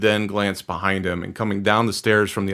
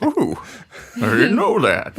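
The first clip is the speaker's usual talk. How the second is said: high, nickering horse voice; 6 semitones higher than usual. Acted. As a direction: gasp it like a surprised horse. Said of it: "That's my horse voice".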